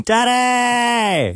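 high, long drawn-out wail; high-pitched mock scream for a parent; imitated child screaming. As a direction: imitate a child yelling from bed, stretched right out. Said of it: "he'll start just screaming".

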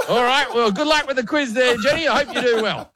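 awkward host wrap-up. Retold or imitated. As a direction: back out of it awkwardly. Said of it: "I just looked at her and went".

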